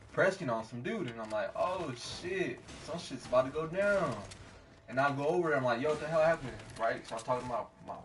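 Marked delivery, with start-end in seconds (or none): 1.6-4.2 raised-pitch "oh shit" reaction; retold alarm. Retells alarm at seeing a confrontation. 5.8-6.5 louder, higher retold question; urgent demand. Retells rushing over to friend.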